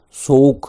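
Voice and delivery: سوک said loud with a barely heard final k; soft ending k. Shown as a demonstration. Teacher to Persian-speaking students, modeling سوک with a faint k. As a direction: model سوک so the last k almost vanishes. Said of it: "یه موشکگی داره".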